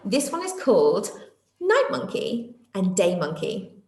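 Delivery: ordinary delivery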